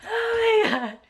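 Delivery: high honking fake sob, half laughing; fake crying. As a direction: ham up a goose-like cry. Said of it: "I sound like a goose crying".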